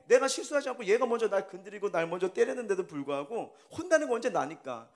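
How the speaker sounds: usual speaking voice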